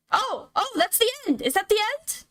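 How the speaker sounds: usual speaking voice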